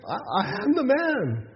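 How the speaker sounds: high, emphatic acted plea to be recognized; insistent protest. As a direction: insist on your identity, pitch jumping up. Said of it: "he kept saying".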